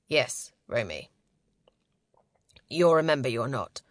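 low, measured man's voice; solemn male leader. A narrator's reading. Leader promising Romy her memories, in a low voice. Leader answers after clearing his throat.

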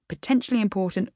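plain reading voice

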